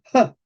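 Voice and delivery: J as a breathy puff, "ha"; aspirated H sound. Shown as a demonstration. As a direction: huff out the sound instead of naming the letter J. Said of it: "se pronuncia con". (sonido aspirado, como un soplo)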